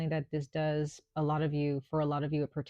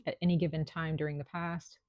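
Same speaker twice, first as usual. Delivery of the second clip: throat clear, then words; dry cough. Real, not acted.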